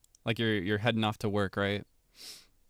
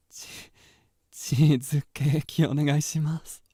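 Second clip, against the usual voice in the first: very slow, drawn-out yandere voice; 4 semitones higher, 4 dB louder, slower than usual. Acted. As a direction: savour each word a little too intently.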